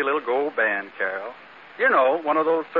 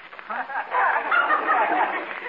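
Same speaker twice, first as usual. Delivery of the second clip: laughing; 11 semitones higher than usual. Acted. Flattered by George Raft comparison.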